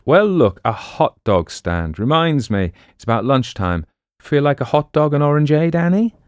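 lightly acted breezy man suggesting lunch; lightly voiced breezy Quaid. Quaid invites Annie to a hot dog, lightly breezy.

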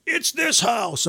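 slow, high mock voice of the hydrant guy. Hydrant guy tells the rookie which house, slow and high.

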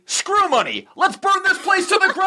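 party rally shout. Acted. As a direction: whip the crowd up and yell it out.

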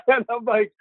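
high, giggly lead-in; laughing. Real, not acted. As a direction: laugh into the words. Laughs at the man's request.

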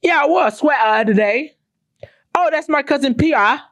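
high, swallowed T British accent mockery. Mocking British singers in a high British accent. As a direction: imitate a Brit dropping every T. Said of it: "They swallow their T when they talk".

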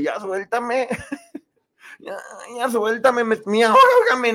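high, whiny plea to be let go; annoyed girl being hugged. Retold or imitated. Aleska pleading with the hugger, high mock whine. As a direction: squeal at him to let go. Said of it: "y la otra así con cara de".

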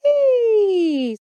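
high, loud siren-like Hey; vocal warm-up siren. Shown as a demonstration. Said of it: "favorite vocal warm-up".

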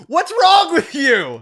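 high, slow, indignant yell; mock outraged yell. Friend teases about a baseball ejection, slow indignant yell. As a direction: drag it out like a baffled kid. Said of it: "and be like".

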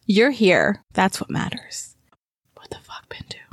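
usual speaking voice

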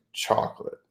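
soft breathy whisper; whispered answer. Retold or imitated. Salesman telling the customer his flavor, in a whisper. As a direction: hush it out like a secret.

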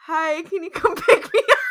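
giggling while voicing him; laughing through a quote. Din voiced calling for pickup.

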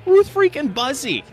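amazed. Real, not acted. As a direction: announce the name with disbelief. Amazed at the surprise message.

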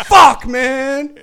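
acted frustrated shout. Reacting to prank call.

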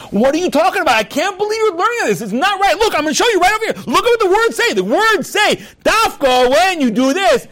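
high-pitched, acted indignant arguing; heated quarrel. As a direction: hammer your point at a study partner, voice way up. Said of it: "they become haters of one another".

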